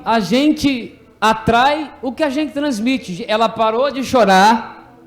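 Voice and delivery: loud, insistent preaching with punched words. Acted. Teacher drives home the lesson to children, preaching. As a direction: preach it to the class with force.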